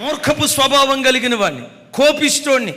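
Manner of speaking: high, loud preaching cry; fervent preaching. Acted. Preacher proclaiming to the congregation in a loud fervent cry.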